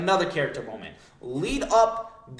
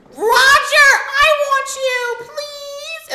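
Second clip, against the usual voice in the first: very high, drawn-out shriek; hammed-up desperate pleading in falsetto; lovestruck squeal; 15 dB louder, much slower than usual. Gloriosa throwing herself at Roger, lovestruck squeal.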